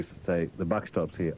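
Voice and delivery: usual speaking voice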